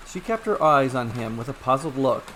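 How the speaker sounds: ordinary delivery, nothing marked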